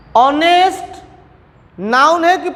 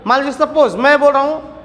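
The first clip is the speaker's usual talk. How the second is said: ordinary talk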